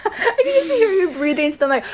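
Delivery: fast, giggly teasing; chuckling while teasing; laughing. Real, not acted.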